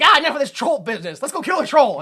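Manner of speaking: loud, high, hammed-up impatience; rally the crowd in mock anger; mock mob cry. Townspeople setting off after the troll, mock mob cry.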